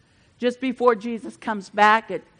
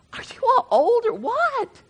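high-pitched mock astonishment; playful, incredulous ribbing; teasing disbelief; 13 semitones higher than usual. Real, not acted. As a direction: tease the crowd, pitched way up. Teases congregation over not knowing Bluey.

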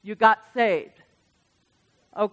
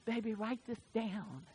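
much softer, intimate voice; God speaking softly; 16 dB quieter than usual. Retold or imitated. God giving a young man a song, much softer. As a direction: say it softly, like a tender secret. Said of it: "he said".

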